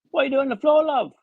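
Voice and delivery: a hint of a friendly British nurse; British accent. Nurse asking the fallen patient, light British accent. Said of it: "That's a fantastic British accent there".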